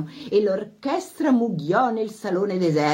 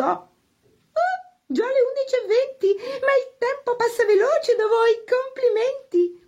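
very high, affected spinster voice; mock-sweet guest; 4 dB louder than usual. A narrator's reading. Isabel to her hosts as the hour grows late, affected. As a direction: pay a compliment with a sting in it.

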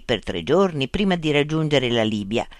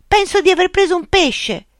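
high-pitched calling out from afar; excited shout; 4 dB louder than usual. A narrator's reading. Luca to his friend from far out in the bay, shouting. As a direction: shout the news across the water. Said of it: "Luca gridò".

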